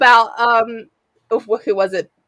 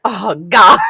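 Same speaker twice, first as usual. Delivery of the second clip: mock groan; 2 semitones lower, 9 dB louder than usual. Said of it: "he's like".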